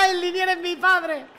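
high, slow, gloating proclamation; drawn-out victory taunt; gleeful taunt. Real, not acted. Gloating chant. (burla triunfal, aguda y lenta)

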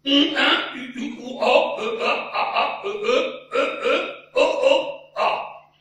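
Un, un, U, U, O said with every consonant dropped, loud and laughing; vowels only. Shown as a demonstration. Repeating the chocolate phrase without consonants, loud laughing vowels. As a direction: shout just the vowels of combien coûte. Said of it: "en supprimant toutes les consonnes".

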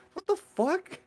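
a high, squeaky what the fuck; disbelieving squeak. Retold or imitated. Telling friends his Starbucks story, incredulous high squeak. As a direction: jump way up in disbelief.

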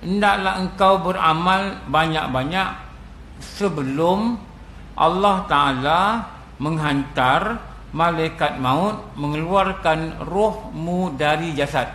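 each word drawn out, slow and grave; slow solemn recitation. Shown as a demonstration. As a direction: lay down the saying slowly, for the congregation to keep. Repeats quote, slow and weighty.

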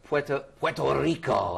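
put-on playful Latin accent on the place name. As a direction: roll it out in a jokey Latin accent. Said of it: "I'd say that with my best, uh, Latin accent".

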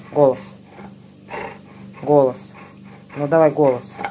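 loud, insistent commands to a dog; firm dog commands. Real, not acted. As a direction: keep pressing the dog to bark. Repeated speak commands to dog.

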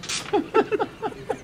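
breathless high giggle, quiet; real giggle while telling the nurse story; high airy giggle. Real, not acted. Laughing retelling nurse story.

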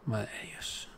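whispered in disbelief; soft whisper of shock; awed whisper. Real, not acted. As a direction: breathe it out under your breath, stunned. Shocked at Austria's fate.

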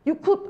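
"could" as one high syllable, final d stopped. Shown as a demonstration. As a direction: say "you could" with "could" cut short like "kut". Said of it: "could가 아니고 you".

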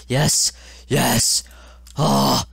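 loud cries of relief; triumphant shout. Real, not acted. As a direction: shout in victory. Finally beats the level.

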